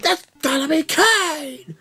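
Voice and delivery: high, slow, drawn-out hype call; shrill-pitched wrestling commentator chant; wrestling announcer call. Retold or imitated. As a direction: call his name like a ringside commentator. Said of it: "I was like".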